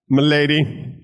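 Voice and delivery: mock British lordly address. Addressing a lady as British lord, mock British accent. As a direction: play a hammy British lord. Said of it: "you sound like a British lord".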